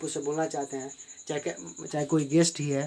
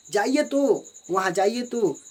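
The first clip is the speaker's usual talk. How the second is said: higher and louder, insisting; emphatic command; 4 semitones higher, 5 dB louder, slower than usual. Shown as a demonstration. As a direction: demand it forcefully. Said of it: "हम वाक्य पर जोर दे रहे हैं".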